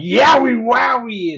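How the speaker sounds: high, loud, drawn-out holler; retold yell. Fan re-enacting his shout at Bray Wyatt, goofy yell. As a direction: call it out like a catchphrase. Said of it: "after going".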